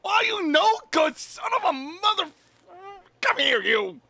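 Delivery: very high, loud, gleeful cry; half-crying happy reunion shout; overjoyed mock insult. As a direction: hug a friend with a joyful yell. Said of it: "I was so happy to see her".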